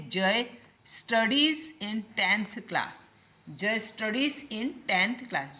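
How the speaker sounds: very slow, each word spaced; teacher drawing out each word; slow dictation. Shown as a demonstration. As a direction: dictate the sentence slowly. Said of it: "teacher models answer slowly".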